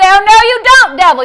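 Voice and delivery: high, loud burst of refusal; defiant rebuke. Shown as a demonstration. Preacher models what believers say to the devil, loudly defiant. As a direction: shout the devil down. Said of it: "You say".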